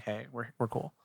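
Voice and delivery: ordinary talk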